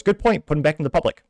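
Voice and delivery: a hint of a breezy cartoon judge; mock judge. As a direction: toss it off like a careless cartoon judge. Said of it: "The judge goes".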